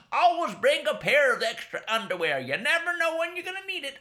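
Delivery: high, loud bossy aunt voice; aunt warning Chico, high fussy voice; aunt's advice. Acted. Aunt Bunny's advice quoted.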